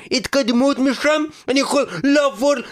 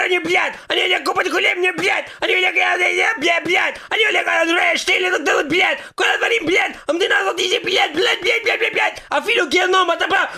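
put-on higher and higher shouting in a Russian accent, cursing; furious Russian rant; 6 semitones higher than usual. Immigrant demon venting about everything, escalating Russian-accented shouting.

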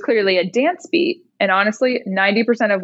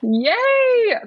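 happy yay; 13 semitones higher than usual. Real, not acted. Asked to perform her rap.

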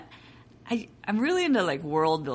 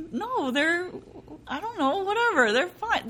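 flustered denial; 12 semitones higher than usual. Retold or imitated. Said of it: "And I'm like".

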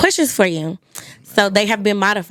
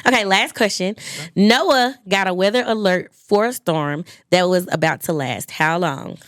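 normal talking voice

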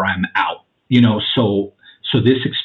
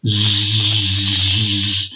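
a low electric zzz through the teeth; mock electric hum; imitated buzz; 3 semitones lower than usual. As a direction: buzz like live wires humming. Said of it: "like, electricity".

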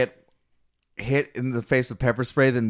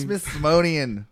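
slow drawn-out word; much slower than usual. Pretend. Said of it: "The Smithsonian?".

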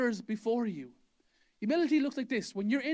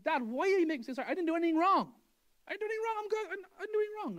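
high-pitched exasperated protest, much higher; retold angry protest; 9 semitones higher, much faster than usual. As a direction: replay a young man's angry objection to his dad. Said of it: "I got really angry".

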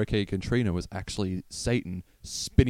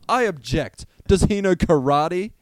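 higher, louder mock objection; indignant churchgoer; 7 semitones higher, 6 dB louder than usual. A churchgoer heckling the preacher in loud mock outrage.

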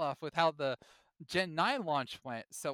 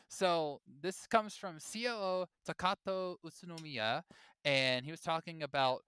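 usual speaking voice

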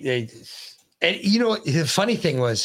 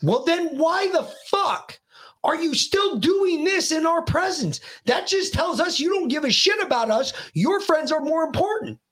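yelling tirade; 10 semitones higher than usual. Retold or imitated. Dad confronts son over phone at dinner, yelling tirade. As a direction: re-enact a wounded, furious scolding. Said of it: "after he was done yelling".

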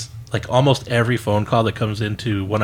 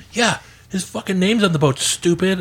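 snarky jeer; 7 semitones higher than usual. Retold or imitated. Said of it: "some people like".

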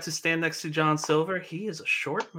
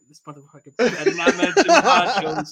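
louder, laughing through the grumble; laughing mock dread; 8 dB louder than usual. Host checking his watch, laughing at his own dread. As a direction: grumble it while cracking up. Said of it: "I'm like".

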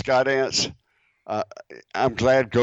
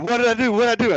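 much higher, hammed-up panic repeated; mock panicked pilot; 13 semitones higher, much faster than usual. Mocking the pilot's panic over the FAA, hammed-up. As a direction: squeak it out like a caught pilot. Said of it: "and you're going".

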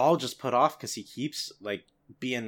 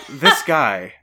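exasperated; 2 semitones higher, 13 dB louder than usual. Real, not acted. Mock exasperation at a friend.

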